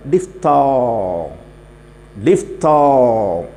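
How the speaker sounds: Diphthong very slow, long falling last vowel; drawn-out term. Shown as a demonstration. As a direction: stretch the "tho" of diphthong right out. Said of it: "മൂന്നാമതൊന്നുണ്ട്". (Diphthong നീട്ടി പതുക്കെ)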